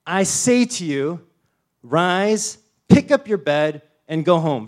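loud, slow, ringing command; preacher voicing Jesus commanding the paralytic, loud. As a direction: order him to rise.

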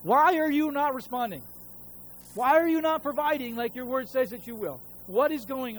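loud, high, exasperated demand; frustrated at God. Retold or imitated. Pastor pleading angrily with God over unpaid rent, loud and exasperated. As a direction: throw the why at heaven, fed up. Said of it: "getting frustrated and angry at God".

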